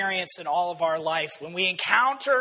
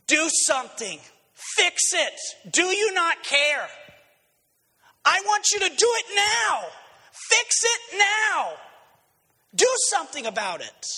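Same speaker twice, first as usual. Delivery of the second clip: higher, rising to a shouted, slowed fix it now; frantic demand; 7 semitones higher than usual. Retold or imitated. Disciples demanding Jesus fix the storm, frantic rising shout. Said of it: "is the demand".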